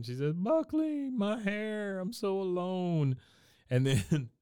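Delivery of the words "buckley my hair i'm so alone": mock sobbing. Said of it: "She starts sobbing".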